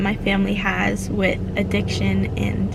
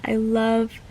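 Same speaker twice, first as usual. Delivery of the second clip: ordinary delivery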